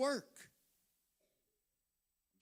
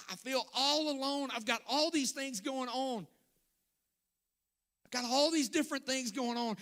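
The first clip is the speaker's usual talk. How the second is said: higher, raised, pleading complaint; complaining to God; 5 semitones higher than usual. Retold or imitated. Believer complaining to God, pleading and raised. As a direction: whine it to God, feeling forgotten. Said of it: "we end up asking Jesus".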